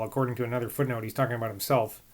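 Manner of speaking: normal talking voice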